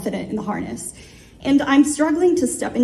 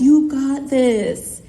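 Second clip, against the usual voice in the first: slow, stretched-out words; each word stretched for a lip-reader; exaggerated mouthing; 3 semitones higher, 3 dB louder, much slower than usual. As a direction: imitate mouthing it over loud wind, very drawn out. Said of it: "Caleb mouths".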